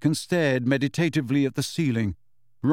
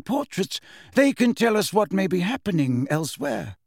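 higher-pitched, authoritative character voice; headmaster, explaining; 6 semitones higher than usual. Acted. Dumbledore explains how portraits travel, in headmaster voice.

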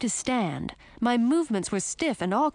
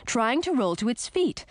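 plain reading voice

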